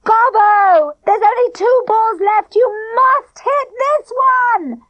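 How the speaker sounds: high, loud desperate bellow; girl bellowing at the batsman, high and loud. A narrator's reading. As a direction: scream at him from the boundary. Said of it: "Clipper stood up and bellowed".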